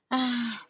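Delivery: soft drawn-out sigh; audible "ah" sigh; demonstrated sigh. Shown as a demonstration. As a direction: let out a sigh to show what sighing is. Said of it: "Sigh is when you do".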